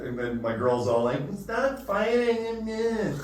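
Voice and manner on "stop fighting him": put-on much higher, very slow laughing girly voice; high slow mock drunk girl; mock girlfriend plea. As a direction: drawl it out like the drunk girlfriend, giggling. Girlfriend quoted during fight.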